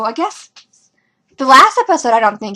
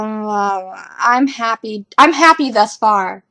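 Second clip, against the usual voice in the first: ordinary delivery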